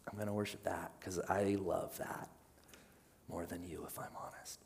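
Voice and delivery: hushed honest confession trailing off; quiet confession. Retold or imitated. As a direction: admit it under your breath. Confessing idol-worshipper, fading.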